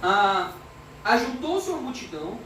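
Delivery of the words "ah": ordinary talk, nothing marked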